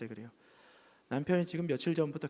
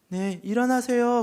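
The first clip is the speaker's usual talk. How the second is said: higher, louder, slow call to wake him; 8 semitones higher, 9 dB louder, slower than usual. Retold or imitated. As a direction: call him up gently but firmly. Pastor calling the sick man. (환자를 깨우며 크게 부르는 목소리)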